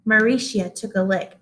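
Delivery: ordinary delivery, nothing marked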